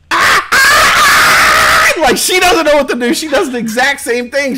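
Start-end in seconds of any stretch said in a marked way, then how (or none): 0.1-2.0 high loud trumpeting yells; imitating a contestant's elephant sound; mock elephant cry. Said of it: "what does she do?".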